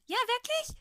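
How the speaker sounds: put-on very high, loud boyish question; eager prodding. A narrator's reading. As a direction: keep pestering, squeakily eager. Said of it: "stochelte er weiter".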